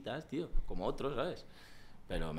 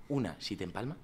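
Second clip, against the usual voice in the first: normal talking voice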